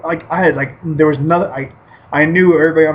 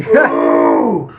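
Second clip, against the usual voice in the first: hammed-up low groan of pain; pained low ooh; 4 dB louder than usual. Fighter groaning after the kid's hit.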